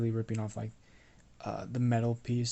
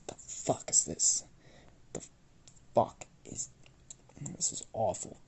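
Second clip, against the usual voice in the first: disgusted whisper. Acted. Smith whispering at a crumbling sword handle, disgusted. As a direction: breathe it out in disbelief.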